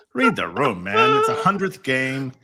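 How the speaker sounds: exasperated outburst. Real, not acted. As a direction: throw your hands up, read the room, man. Exasperated rant at the referee.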